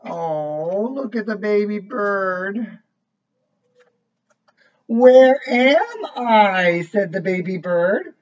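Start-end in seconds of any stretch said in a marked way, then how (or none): 4.9-6.8 slow, higher and louder; lost and scared. A narrator's reading. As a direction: ask it lost and bewildered. Lost bird asks.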